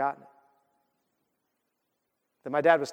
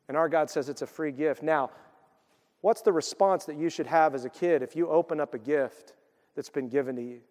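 ordinary delivery

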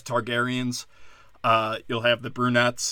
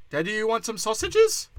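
much higher and a touch louder, eagerly coaxing; mock eager offer; 9 semitones higher, 4 dB louder than usual. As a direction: push the offer up high and eager. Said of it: "We've always been like".

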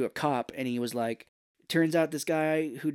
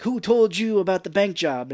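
high, loud demanding question; raised, forceful retold demand; angry interrogation; 6 semitones higher, 7 dB louder than usual. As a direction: press him like a movie tough guy. Acted interrogation from film.

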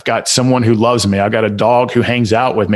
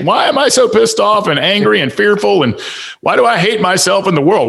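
higher, exasperated inner voice; exasperated self-talk; 10 semitones higher than usual. Retold or imitated. Imitating a listener's exasperated self-talk about being angry. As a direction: imitate someone fed up with themselves. Voicing a frustrated listener's thoughts.

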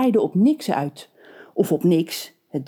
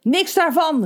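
mildly higher crowd call; townsfolk calling out; 9 semitones higher than usual. A narrator's reading. Townsfolk calling to the witch, a touch higher. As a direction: lightly call back like an annoyed crowd. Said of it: "riepen de Glamsterdammers".

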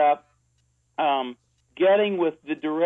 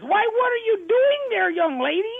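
put-on much higher, cackly old granny in a radio skit; Granny Loomis voice. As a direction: play the fussy old grandmother, pitched way up. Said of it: "Granny Loomis comes in".

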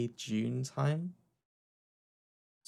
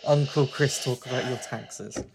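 storytelling uncle; 4 dB louder than usual. Pretend. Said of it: "gather round, children".

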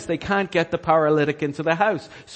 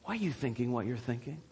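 hushed question; 5 semitones lower, 13 dB quieter, faster than usual. Acted. Hushed repeat of Jesus's question.

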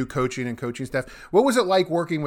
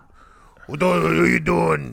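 higher, garbled mock Louisiana coach; 4 semitones higher, 4 dB louder than usual. Host mocks playing for a Louisiana coach, garbled impression. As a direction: slur it in a hammed-up Cajun growl. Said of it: "trying to play for Coach O".

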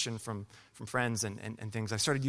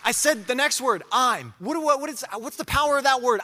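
much higher and louder, a preacher in full flight; preaching at full force; 11 semitones higher, 6 dB louder than usual. Acted. Preacher testifying to the church, building to full-force preaching.

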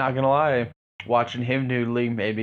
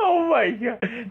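laughing hard; 10 semitones higher, much slower than usual. Real, not acted. Laughing at the story.

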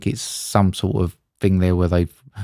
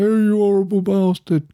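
much higher, indignant woman shouting; angry stranger; 11 semitones higher than usual. Retold or imitated. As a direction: hurl it like a furious fan on the street. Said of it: "this woman's going".

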